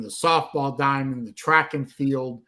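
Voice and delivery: usual speaking voice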